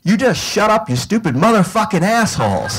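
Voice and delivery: put-on high-pitched tirade from a tiny girl; mock little-kid outburst at bullies; imitated child's scream. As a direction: yell it like a furious three-year-old. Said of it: "she screamed".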